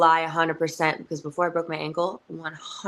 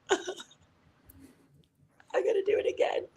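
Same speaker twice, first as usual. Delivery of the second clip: overwhelmed, breaking into a whisper; emotional; 4 dB quieter, faster than usual. Retold or imitated. Wrestler recalls pulling up to the venue, choked-up whisper. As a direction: act choked up in a small, high whisper. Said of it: "I'm so much more emotional now".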